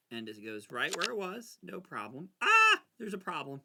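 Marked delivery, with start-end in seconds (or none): 2.4-2.8 short, high, loud "ah"; high yelp of alarm; sudden startled yell. Real, not acted. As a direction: cry out in alarm at a mistake. Startled by a problem mid-game.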